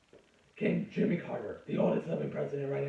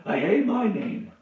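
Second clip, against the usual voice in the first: loud, exasperated complaint; frustrated outburst; 8 semitones higher, 9 dB louder than usual. Real, not acted. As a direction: blurt it out in real frustration. Frustrated outburst about own name.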